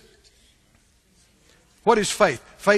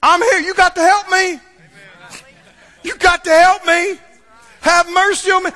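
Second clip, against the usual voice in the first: raised, insistent begging; persistent pleading; 9 semitones higher, 6 dB louder than usual. Retold or imitated. Mother refusing to leave, pleading loudly for help. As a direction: keep pressing, won't be sent away. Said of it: "she just right there going".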